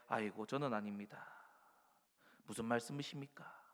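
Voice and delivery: soft, shrinking reluctance; mild hushed protest; timid refusal. Acted. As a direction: back away from the calling quietly. Gideon refuses.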